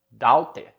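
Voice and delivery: "daughter" read as DAU-te, open au and clear t; Portuguese-style misreading. Shown as a demonstration. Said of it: "ou qualquer coisa".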